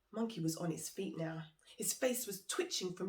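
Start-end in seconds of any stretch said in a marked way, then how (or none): none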